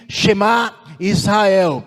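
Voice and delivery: slow, loud retold proclamation; imitating a crowd crying out; crowd's cry. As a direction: call it out slowly as the people's declaration. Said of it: "Eles clamam novamente e dizem".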